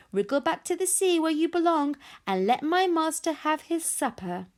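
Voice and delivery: high, haughty dismissal; crowing taunt. A narrator's reading. Fireworm ordering the monster back to sea, crowing. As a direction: send the loser packing in triumph. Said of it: "crowed Fireworm".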